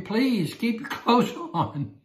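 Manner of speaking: ordinary talk, nothing marked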